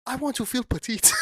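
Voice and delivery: put-on African accent. Son confessing a wish to his father, mock African accent. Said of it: "doing accents like these".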